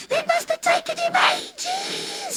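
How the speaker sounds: high, giggly little brother; giggling brother. Acted. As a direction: say it through giggles.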